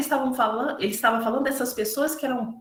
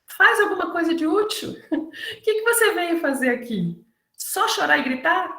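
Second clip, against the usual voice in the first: raised rebuke; 7 semitones higher than usual. Retold or imitated. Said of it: "diz, ele".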